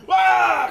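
sudden loud cry of frustration; frustrated shout. Real, not acted. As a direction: yell out in real frustration. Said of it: "You can hear the frustration".

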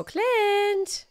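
very high, drawn-out call. Pretend. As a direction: sing out the name high. Calling out to character Clint.

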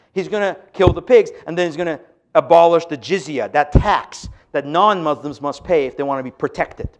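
ordinary talk

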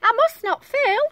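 high, loud little voice; tiny determined snail. A narrator's reading. Snail resolves to rescue the whale, high tiny voice. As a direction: pipe up high and firm. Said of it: "said the tiny snail".

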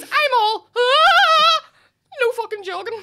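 high, loud hammed-up wail; mock-hysterical mother, laughter in the voice; imitating ma's meltdown. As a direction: wail like an overcome mum, then order. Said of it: "she goes".

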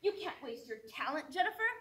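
imitated pushy elders, swinging in pitch; insistent mock scolding, building in urgency; nagging elders. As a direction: press each demand, then tag on the name. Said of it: "voices of society and my elders saying".

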